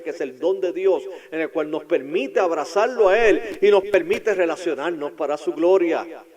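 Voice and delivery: loud, high fervent preaching; preaching at full force. Real, not acted. Pastor to his congregation, fervent preaching. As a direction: build the sermon to its peak.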